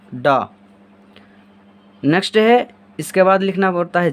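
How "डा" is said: "डा" with a retroflex d, tongue curled back, falling; letter sound demo. Shown as a demonstration. Teacher shows the retroflex sound "डा" to pupils. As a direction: model the hard "डा". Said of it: "इसका उच्चारण हो जाएगा".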